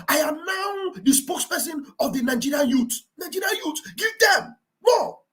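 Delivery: high-pitched mock proclamation; imitated spokesman's demand. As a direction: play the insistent spokesman. Acting out self-appointed youth spokesman demanding.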